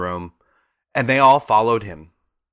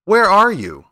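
calling out; 9 semitones higher, 7 dB louder than usual. Acted. Dorothy calls to unseen voice.

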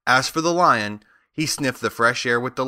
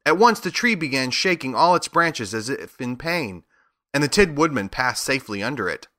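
usual speaking voice

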